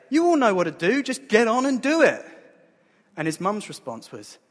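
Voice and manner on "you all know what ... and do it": loud, high, emphatic outburst; exasperated mock preaching. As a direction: blurt it out like a fed-up child playing preacher. Child's imagined blunt preaching, raised voice.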